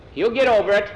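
loud, high, confident dismissal; booming captain's assurance; hearty sea captain. A narrator's reading. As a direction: brush it off like an old salt. Captain brushes off the illness.

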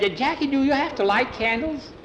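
high-pitched, excitable questioner; high character voice. Acted. Skeeter asks Jackie about lighting the candles, high character voice. Skeeter pipes up in told story.

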